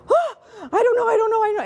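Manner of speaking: high, loud, panicky I don't know; panicked student. Retold or imitated. A student grilled by the professor, high panicked squeal.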